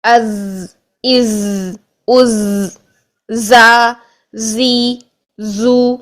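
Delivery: sustained voiced z, drawn-out vowels in زا، زی، زو; za sound drill. Shown as a demonstration. Teacher showing students how za sounds, از and زا held long. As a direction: buzz and hold the z in each از, then long زا، زی، زو. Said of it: "اب اسی طرح زا کو دیکھیے".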